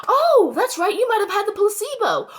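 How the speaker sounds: high, loud mock surprise; sarcastic realization. Taunting those who got the shot, mock-surprised. Feigned realization after a gasp.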